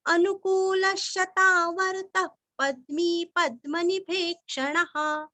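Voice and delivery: steady, even chant of the shloka; measured devotional chanting; Sanskrit recitation. Acted. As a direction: intone each line evenly for pupils to follow. Repeats full shloka in chant.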